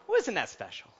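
prim, smug church lady voice; pursed-lips Church Lady catchphrase. Retold or imitated. Preacher quoting the Church Lady to the congregation, prim and smug.

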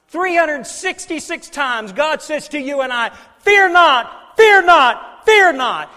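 loud, high-pitched preacher's proclamation; full-force preaching. Real, not acted. Preacher telling congregation God says fear not, full-force preaching. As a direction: hammer each phrase out to the congregation.

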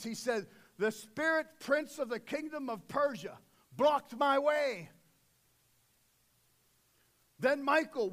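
loud, driving sermon delivery; booming pulpit voice; full-force preaching. Acted. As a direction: preach it to the back row.

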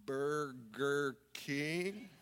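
very slow, syllable by syllable; real slow. Acted. Counter kid answering the arguing couple, real slow. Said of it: "Would you do it real slow".

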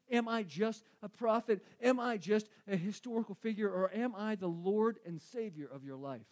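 ordinary delivery

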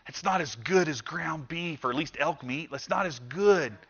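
grumbling. Pretend. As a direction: moan it under your breath like a sulking diner. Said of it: "some of you might grumble and go".

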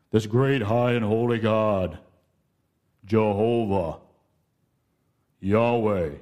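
lightly grand, solemn voice; a hint of distant majesty; lofty holy tone. Shown as a demonstration. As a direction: intone the holy names with awe. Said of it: "hear that in the tone of my voice".

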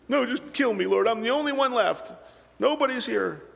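high, despairing whine; whining. Retold or imitated. Elijah begging the Lord to take his life, high and whiny. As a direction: beg to die like a sulking child. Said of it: "kind of a little bit whining".

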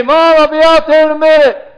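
called out high and loud to Mom; a son calling back from across the room, loud and high; loud, high call to his mom; mock call out. Imagined reply to his mother.

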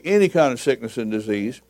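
normal talking voice

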